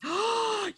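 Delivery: big theatrical intake of breath; exaggerated gasp of shock; mock shocked gasp. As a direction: gasp as if scandalized. Mock shock at Canadian reveal.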